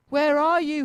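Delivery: high, loud searching call; a storybook driver hunting lost hens, calling out. A narrator's reading. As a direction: call out across the town. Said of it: "he cried".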